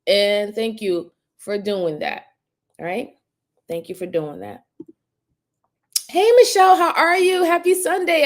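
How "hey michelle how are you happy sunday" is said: bright, much higher, louder hello to a viewer; excited greeting. Real, not acted. Greeting Michelle in chat, high and excited. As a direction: sing out the welcome, lifted high.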